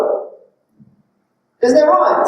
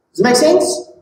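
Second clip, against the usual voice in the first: usual speaking voice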